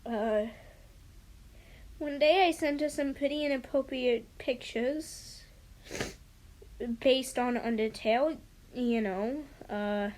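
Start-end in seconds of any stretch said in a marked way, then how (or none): none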